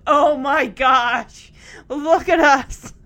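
loud, drawn-out mock amazement; voicing jaw-dropped disbelief; amazed. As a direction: gasp it out slowly and loudly for both girls. Voicing two girls' amazed reaction.

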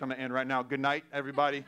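normal talking voice

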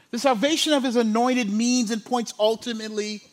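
normal talking voice

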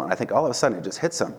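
ordinary delivery

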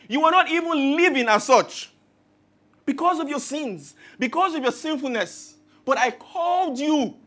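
loud, rising pulpit cry as God; preaching at full force. Acted. Preacher voicing God calling the unfaithful back, fervent. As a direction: proclaim it like God pleading with his people.

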